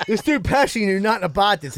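ordinary talk

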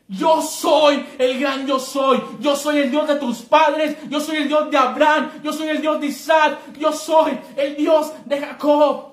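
God announcing himself to Moses, booming; booming declaration. Acted. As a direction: thunder it as God.

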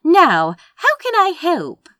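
high, loud, bustling helpfulness; eager hostess. A narrator's reading. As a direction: offer help with bustling energy. Bustling hostess offers help.

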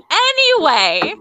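loud, high, drawn-out "Anyway"; emphatic anyway. Real, not acted. Host dragging friends back to the story, loudly insistent. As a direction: stretch it out and override the chatter. Host repeats to override the interruption.

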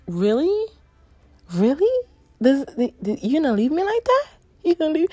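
high, fast, outraged "really?"; indignant disbelief. Retold or imitated. To the partner who stopped, in high indignant disbelief. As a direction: act betrayed and unbelieving, rising. Retelling disbelief at being left.

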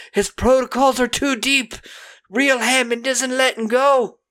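high, odd mock villain; evil robot cop voice. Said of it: "the evil cops that are like".

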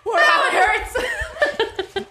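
high helpless laughter through the words; cracking up in pain; laughing. Real, not acted.

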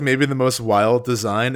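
ordinary delivery

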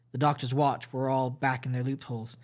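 plain reading voice

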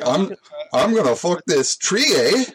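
high mock yokel voice. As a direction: ham up a dopey backwoods guy. Acting out horny syrup discoverer.

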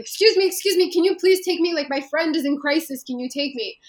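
frantic plea to a van driver; retold urgent plea. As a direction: relive begging a stranger for help, urgent. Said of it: "in serious distress".

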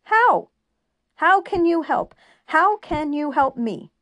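very high, loud angry yell fading to bitter; furious shout. A narrator's reading. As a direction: lash out at her, then grind on bitterly. Julian turns on her angrily.

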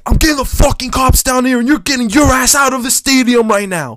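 high, loud mock threat; imitating a furious neighbour; threatening shout. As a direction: yell the threat at him. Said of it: "He's like".

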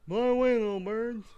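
Rocky impression. Shown as a demonstration. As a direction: mumble it like a punch-drunk boxer. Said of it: "let me try again".

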